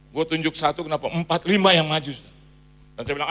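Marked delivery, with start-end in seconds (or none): none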